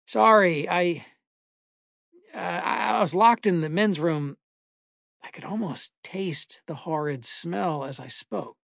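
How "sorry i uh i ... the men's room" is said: raised, halting explanation; calling out, hesitant. A narrator's reading. Trapped man explains himself to a possible stranger, calling out haltingly. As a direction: stammer out a higher-pitched apology to whoever's there. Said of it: "I called out".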